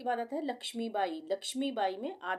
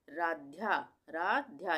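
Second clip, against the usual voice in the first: normal talking voice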